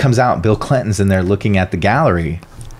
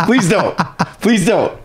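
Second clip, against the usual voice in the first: high-pitched, playful begging; mock pleading; 9 semitones higher, slower than usual. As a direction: plead jokingly after a wisecrack. Pleads jokingly over laughter.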